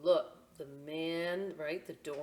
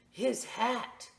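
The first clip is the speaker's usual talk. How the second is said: noticing; 6 semitones higher, 4 dB louder than usual. Acted. Boy notices hat.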